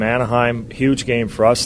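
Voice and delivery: usual speaking voice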